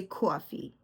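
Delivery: "coffee" with a Bronx "aw" vowel; New York accent. Shown as a demonstration. A New Yorker owning how she says it, New York accent. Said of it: "That's my New York accent".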